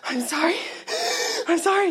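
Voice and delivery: high, gasped mock apology; hyperventilating. Speaker re-enacts apologizing to family while starting to cry, hyperventilating. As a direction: imitate apologizing through panicked breaths. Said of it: "And start hyperventilating".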